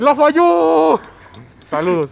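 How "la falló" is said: drawn-out high, loud lament; commentator-like shout. Acted. Friend blew it like a missed goal, drawn-out shout. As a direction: yell it slowly like a missed goal.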